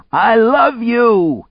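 high, drawn-out full shout. A narrator's reading. He shouts his love to her, shouted. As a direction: shout it slowly at the top of your range. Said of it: "he shouted".

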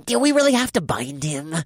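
nasal grumble. Acted. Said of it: "the nasally voice grumbled".

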